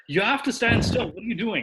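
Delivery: loud, high-pitched exasperated correction; mock scolding. Acting out scolding a pad holder.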